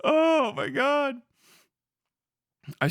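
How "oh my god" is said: mock exasperation. Exaggerated reaction.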